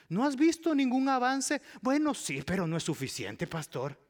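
high, loud whiny protest; hammed-up grumbling spouse; mock complaint. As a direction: play the unconvinced spouse.

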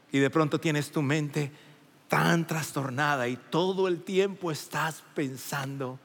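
ordinary talk

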